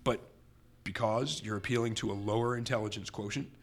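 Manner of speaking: usual speaking voice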